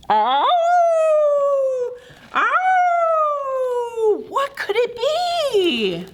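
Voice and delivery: pained cry. Acted. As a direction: call out in pain. Mystery cry.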